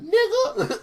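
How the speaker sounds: high squeaky indignant yelp; mock squeaky outrage. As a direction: squeak it out in disbelief. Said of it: "Connor turns around and said".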